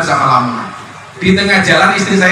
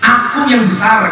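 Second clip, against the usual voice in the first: high, commanding divine declaration; preacher voicing God, higher pitch; 7 semitones higher than usual. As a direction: speak as God humbling a proud man. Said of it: "kata Allah".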